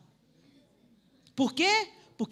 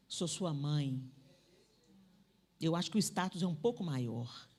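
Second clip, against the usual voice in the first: mother to daughter; 6 semitones lower than usual. Retold or imitated. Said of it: "virei pra minha filha e falei assim".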